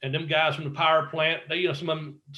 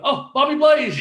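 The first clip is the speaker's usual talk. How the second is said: much higher and louder, thrilled to see him; delighted greeting; 11 semitones higher, 8 dB louder than usual. Retold or imitated. Robbie greeting Bobby Blaze, thrilled, high and loud. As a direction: burst out with the name like an old friend.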